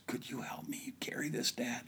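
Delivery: put-on breathless little whisper of a worn-out kid; winded whisper. Tired son whispering to dad after the climb. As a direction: whisper it, spent from the hill. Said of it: "he's winded".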